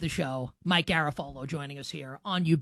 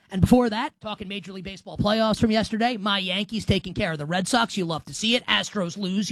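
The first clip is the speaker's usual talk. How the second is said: ordinary talk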